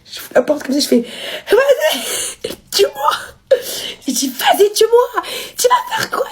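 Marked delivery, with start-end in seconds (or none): 1.5-6.3 shrill high scream; screaming dare. Retold or imitated. Daring her father to kill her, shrieking high. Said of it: "je commence à crier".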